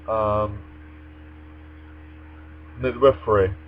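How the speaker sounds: ordinary delivery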